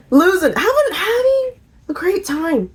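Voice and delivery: high, laughing and slowed; giggly high recounting. Real, not acted. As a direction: laugh the words out. Laughing while describing fun.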